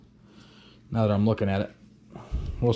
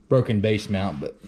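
ordinary delivery

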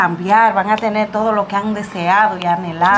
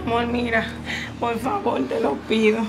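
softer pleading in the wife voice; soft plea; 5 dB quieter than usual. Acted. Wife begging husband not to stop calling, softly. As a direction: beg him quietly.

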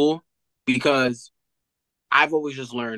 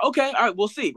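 skeptical coach; 9 semitones higher than usual. Retold or imitated. Coach answers tired player skeptically.

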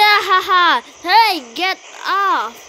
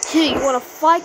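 normal talking voice

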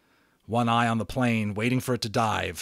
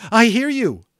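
much higher, much louder rushed answer; eager reply; 12 semitones higher, 11 dB louder than usual. Acted. Boy answering the radio voice, eager and loud. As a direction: grab the chance, blurt it out.

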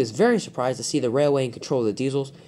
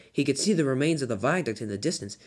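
ordinary delivery, nothing marked

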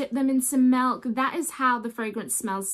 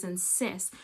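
ordinary talk

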